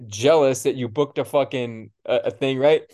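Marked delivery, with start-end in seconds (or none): none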